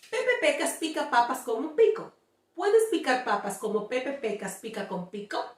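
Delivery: quick tongue-twister run; fast demo. Shown as a demonstration. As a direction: rattle it off quickly. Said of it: "let me do it slowly".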